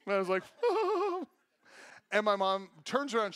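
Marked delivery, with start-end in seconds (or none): 0.5-1.2 high-pitched mischievous snicker; gleeful giggle. Retold or imitated. Kid giggling under a mannequin's dress, gleeful high giggle.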